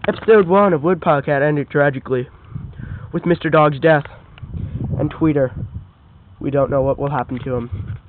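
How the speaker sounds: low mock-solemn narrator voice; closing narration. A narrator's reading. Narrator closing the first episode, low solemn voice. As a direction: wrap up the episode like a trailer narrator.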